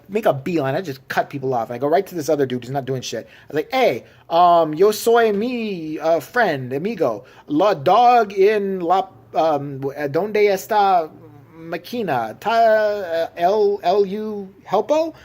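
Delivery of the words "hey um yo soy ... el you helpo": slow, loud fake Spanish, halting and unsure; hammed-up broken Spanish with made-up words; mock broken Spanish. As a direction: butcher Spanish words with slow, exaggerated care. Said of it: "I don't speak a word of Spanish".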